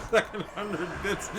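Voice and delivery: words squeezed out through laughter; laughing. Real, not acted. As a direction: laugh while saying it. Laughing at a viewer's request.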